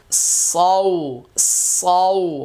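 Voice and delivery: সৌ drawn out slowly with a heavy, thick S; slow, heavy-mouthed সৌ; heavy, thick sound. Shown as a demonstration. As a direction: say সৌ thick and full, held long. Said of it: "মোটা করে".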